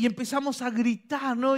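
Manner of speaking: usual speaking voice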